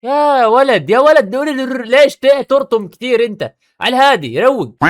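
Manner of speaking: loud, high scolding burst; exasperated scolding. Real, not acted. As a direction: rein him in sharply. Scolding the other creator.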